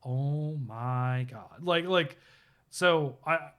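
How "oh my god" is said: slow, drawn-out oh my God; stunned awe. Retold or imitated. Friend reaching the shocking scene, slow and stunned. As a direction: let it sink in slowly, amazed. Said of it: "and he's like".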